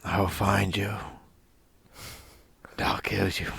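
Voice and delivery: quiet action-hero menace, impersonated; Liam Neeson. Acted. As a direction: deliver a measured threat with long pauses. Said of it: "talking like Liam Neeson from Taken".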